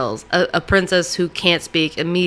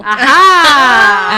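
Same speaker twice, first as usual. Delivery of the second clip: excited; 6 semitones higher, 10 dB louder than usual. Acted. Excited discovery exclamation.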